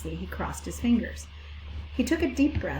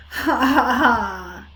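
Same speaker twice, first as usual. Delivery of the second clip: loud bleating mock laugh; a lamb laughing at the pig, bleating loud; 3 semitones higher, 8 dB louder, much slower than usual. A narrator's reading. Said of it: "bleated a big lamb".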